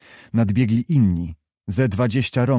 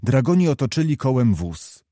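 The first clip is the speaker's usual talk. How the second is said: plain reading voice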